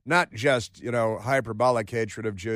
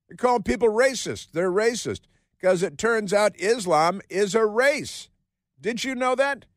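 sarcastic; 8 semitones higher than usual. Acted. Sarcastic mock outrage about racism.

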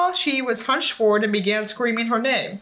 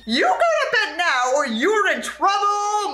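high, slow threatening holler; hollering mom; 9 semitones higher, slower than usual. A narrator's reading. Mom hollering a threat at her son to sleep. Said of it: "my mom hollered back".

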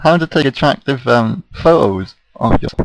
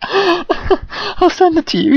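laughing; much slower than usual. Real, not acted. Cracking up mid-sentence.

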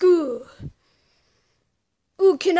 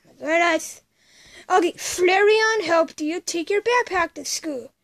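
ordinary talk, nothing marked

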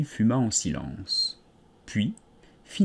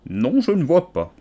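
louder, odd creature voice, blunt; curt caterpillar; 5 semitones higher, 5 dB louder than usual. A narrator's reading. Caterpillar bluntly contradicts the stammering Alice. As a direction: cut her off flatly.

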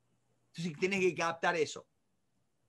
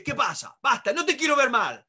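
louder, higher, insistent; acted forceful father; 6 semitones higher, 5 dB louder than usual. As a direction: order him to cheer up, voice raised. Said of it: "si mi padre dice".

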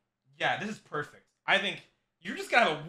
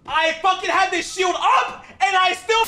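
outraged shout; 8 semitones higher, 5 dB louder than usual. Real, not acted. Player had the shield up anyway, outraged shout. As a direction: shout in indignant disbelief.

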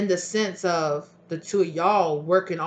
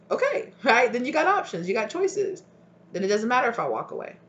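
higher-pitched, emphatic comeback; acted retort; 6 semitones higher, 3 dB louder than usual. Partner answers the boast of options, high-pitched retort. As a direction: throw it back at them, pitch raised.

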